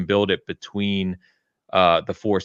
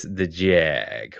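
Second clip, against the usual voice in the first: drawn-out "Jaaag" in mock presenter style; Top Gear catchphrase; 3 semitones lower than usual. Co-host quoting Top Gear, mock presenter drawl. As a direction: stretch out Jag like a TV catchphrase. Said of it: "reference to Top Gear".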